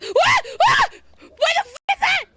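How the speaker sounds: high, loud panicked shrieks; terrified screams. Real, not acted. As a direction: scream in real fright at the monster. Jump scare in horror game.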